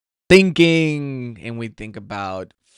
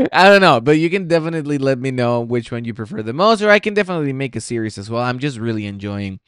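normal talking voice

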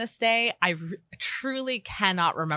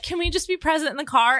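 higher, quick mock-snippy question; snippy remark; 11 semitones higher, 4 dB louder, faster than usual. Retelling her snippy comment to her partner in the car. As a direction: snap the plea out quickly, irritated. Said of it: "a snippy comment".

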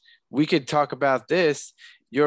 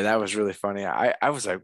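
ordinary delivery

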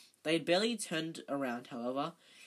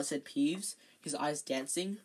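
ordinary delivery

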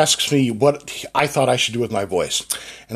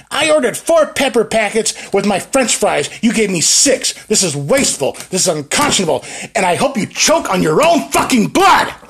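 higher, louder mock tirade at a cashier; half-hammed rant, building to a louder peak at the end; retold yell; 9 semitones higher, 5 dB louder than usual. Ira Glass yelling at a poor cashier, hammed-up fury. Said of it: "and he yells".

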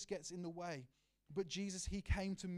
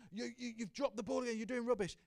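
higher, sharp barked orders from the sidelines; barking manager; 7 semitones higher, 4 dB louder than usual. Retold or imitated. Manager yelling at players from the sidelines, barking. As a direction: bark it like a fed-up football manager. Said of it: "football manager ... barking orders".

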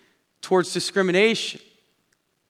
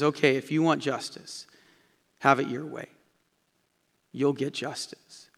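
softer, lower, sad resignation; a sad Saint Peter letting go; sorrowful; 5 semitones lower, 8 dB quieter than usual. Acted. Saint Peter sadly granting the man justice, sorrowful. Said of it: "there's this sad look that comes upon Saint Peter's face".

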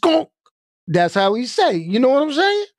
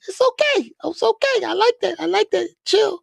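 high-pitched soothing act. Acted. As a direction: talk them down in a squeaky put-on voice. Acts out calming a homeboy in falsetto.

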